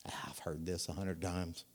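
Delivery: bored, whispered mutter. Retold or imitated. Bored churchgoer checking out, whispered and dismissive. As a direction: sigh it out under your breath. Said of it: "some people check out".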